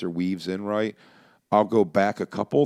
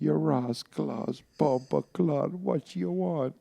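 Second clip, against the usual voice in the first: silly high voice; 9 semitones higher, much slower than usual. Pretend. Silly voice during call.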